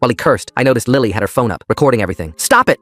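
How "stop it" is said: loud, high scream. Acted. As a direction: scream at them to stop. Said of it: "Mom screamed".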